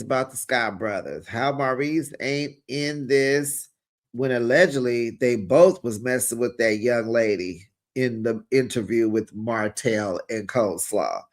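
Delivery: ordinary talk, nothing marked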